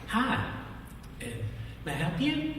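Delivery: high, sweet-voiced lady at the desk; hotel clerk. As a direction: put on the clerk's chirpy welcome. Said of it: "the lady behind the counter said".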